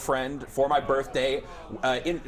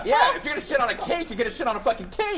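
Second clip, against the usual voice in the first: emphatic; 8 semitones higher, faster than usual. Real, not acted. Emphatic declaration about sitting.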